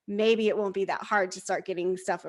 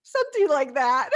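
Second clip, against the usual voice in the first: sheepish laugh; 5 semitones higher, 6 dB louder than usual. Retold or imitated. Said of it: "And I'm like".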